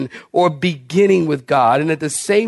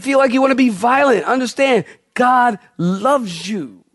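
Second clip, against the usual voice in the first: fervent retold counsel; 6 semitones higher than usual. Pastor retells counsel to teen.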